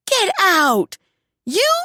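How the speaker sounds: high, loud disbelieving cry; astonished daughter. Acted. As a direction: burst out in shock. Daughter reacts to news.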